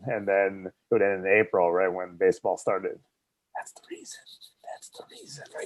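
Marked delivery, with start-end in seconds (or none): 3.6-5.7 hushed, breathy stage whisper. Real, not acted. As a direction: whisper it like a secret.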